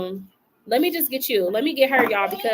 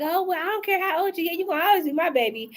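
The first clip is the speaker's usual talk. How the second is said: doting parent; 7 semitones higher than usual. Retold or imitated. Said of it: "And they be like".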